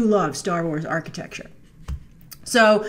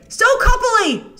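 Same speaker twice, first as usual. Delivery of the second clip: much higher, slow and drawn out; gushing; 13 semitones higher, 5 dB louder, slower than usual. Real, not acted. Fan gushing over the romantic shot, high and drawn out. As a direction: coo over the couple. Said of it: "There, see?".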